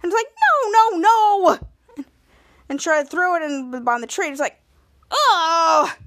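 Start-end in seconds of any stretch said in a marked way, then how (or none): 0.7-2.0 high, loud, drawn-out mock protest; alarmed pleading. Henry begs as his treat is grabbed, alarmed. 5.1-5.9 loud mock wail; dismayed cry. Henry sees his treat thrown away, dismayed.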